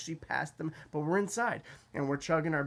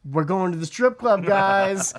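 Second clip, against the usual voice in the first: loud, higher triumphant call; 5 semitones higher, 11 dB louder, slower than usual. Retold or imitated. Narrator telling drunk friends the plan, loud triumphant call. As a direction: announce it to the back seat.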